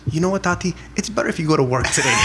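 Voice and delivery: put-on a young son's cheeky quick retort; imitating son. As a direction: blurt it out like a cheeky little boy. Son's punchline voiced.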